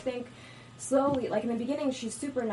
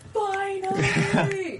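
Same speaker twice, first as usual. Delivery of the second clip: high, loud, stretched-out cry; triumphant finally; 11 semitones higher, 8 dB louder, much slower than usual. Retold or imitated. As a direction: cheer it like a long-awaited win. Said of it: "it was like".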